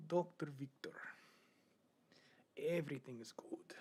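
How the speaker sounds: a light put-on Doctor Victor character, hushed. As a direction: slip into the creepy doctor persona, half whispered. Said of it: "if I do Doctor Victor".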